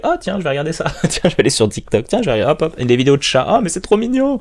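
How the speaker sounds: light, bouncy jumps up in pitch, squeaky high on mignon; mock distracted scroller. To the listener, acting out a distracted scroller, playful mock voice. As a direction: play someone flitting from one distraction to the next.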